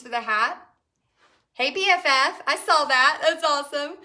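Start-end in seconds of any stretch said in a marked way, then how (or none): 1.5-3.9 louder, higher, bright hello to a friend; excited greeting. Real, not acted. Host greeting her best friend in the live chat, excited. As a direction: call out happily to a friend.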